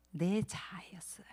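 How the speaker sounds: slow whispered admission; hushed, slow, half-voiced; whispered confession. Real, not acted. Confession in sermon.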